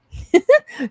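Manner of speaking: high, loud burst of laughter; short laugh. Real, not acted. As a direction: let out a quick high laugh. Laughs at own confusion.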